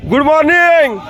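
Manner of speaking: bright, lifted good morning call; cheerful morning greeting. Real, not acted. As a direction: wake everyone up cheerfully. Cheerful morning greeting after night.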